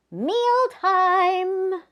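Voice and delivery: cheerful mealtime shout; excited call. Acted. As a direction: announce it like a dinner bell. Announcing dinner in the story.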